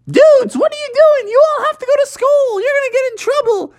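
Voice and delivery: very high, fast cartoon monkey. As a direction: rattle it off like a worried little monkey. George scolding the kids.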